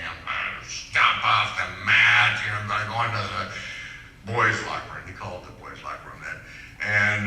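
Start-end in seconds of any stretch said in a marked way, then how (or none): 0.1-5.0 mock teenage anger. Man mimicking his mad teenage self after losing a match, mock angry. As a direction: act out a mad fifteen-year-old, stretching the words. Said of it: "your age fifteen mad thing".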